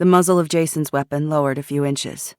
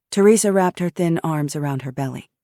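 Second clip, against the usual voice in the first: ordinary delivery, nothing marked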